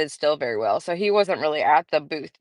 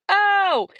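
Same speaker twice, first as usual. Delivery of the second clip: surprised; 13 semitones higher, 4 dB louder than usual. Retold or imitated. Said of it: "and he was like".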